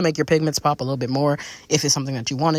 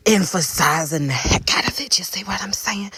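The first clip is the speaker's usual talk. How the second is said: breathy whispered aside. Real, not acted. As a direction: lean in and whisper it to the viewers. Whispered aside during makeup tutorial.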